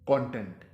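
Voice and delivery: कंटेंट stressed on the first syllable, KON-tent; noun "content". Shown as a demonstration. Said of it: "इसका तलफ़्फ़ुज़ होगा".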